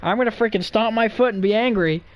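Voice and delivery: lightly voiced coworker pretending to threaten; mock angry threat. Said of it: "he got mad at me".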